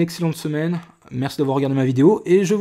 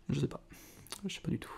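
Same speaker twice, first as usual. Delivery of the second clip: whisper; 16 dB quieter, faster than usual. Real, not acted. Vlogger unsure of a card, whispering to himself.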